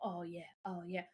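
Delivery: soft, low-key "oh yeah"; easy groove, kept low and quiet; laid-back groove. Retold or imitated. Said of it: "he starts doing the whole".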